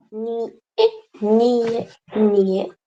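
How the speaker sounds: "Ni, e" as two vowels after an N; separated ñ. Shown as a demonstration. Teacher simulating the ñ sound, "ni, e" in two syllables. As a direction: split "Ni, e", then glide it closer together. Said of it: "separado, así".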